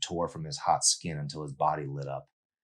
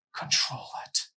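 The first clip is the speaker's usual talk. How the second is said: whispering to himself. Acted. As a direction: whisper it as self-command. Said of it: "he told himself".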